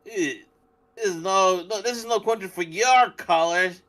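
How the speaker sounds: high-pitched hammed-up Southern homeowner; Southern accent. Co-host improvising a warning to outsiders, high mock Southern voice. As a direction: warn off strangers in a put-on Southern accent. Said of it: "You're getting better".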